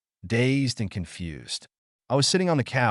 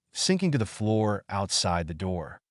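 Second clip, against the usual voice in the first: plain reading voice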